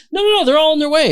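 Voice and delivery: high, fast nervous brush-off; panicked cheerful cover-up; frantic reassurance. Retold or imitated. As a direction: talk her out of worrying, fast.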